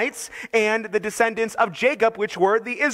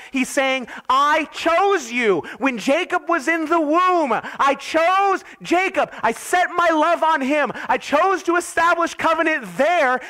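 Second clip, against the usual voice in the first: rising, impassioned pulpit voice, much higher; preaching at full force; 5 semitones higher than usual. Acted. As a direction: build the fervour line by line as you voice God. Preacher voicing God's love.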